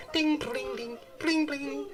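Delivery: high sing-song ding-bling of tiny droplets; sparkly sound effect. Retold or imitated. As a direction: chime it like water sparkling on skin. Imitating droplets on skin.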